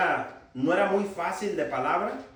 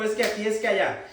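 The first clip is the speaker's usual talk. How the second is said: ordinary delivery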